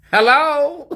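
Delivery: very high sing-song hello. Shown as a demonstration. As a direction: show the high greeting voice. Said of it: "That's my voice".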